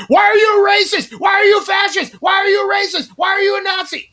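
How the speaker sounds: high, loud repeated accusation; shrill accusing yell. Retold or imitated. Imitating Daryl yelling at him with a phone, high shout. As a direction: shout it in his face, over and over. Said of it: "just starts going".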